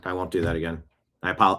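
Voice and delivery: normal talking voice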